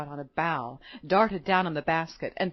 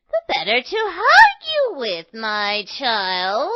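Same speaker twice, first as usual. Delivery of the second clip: wolf playing granny; 3 semitones higher, 7 dB louder, slower than usual. A narrator's reading. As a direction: croon it as a disguised wolf. Wolf answers as grandmother.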